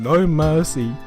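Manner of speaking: ordinary delivery, nothing marked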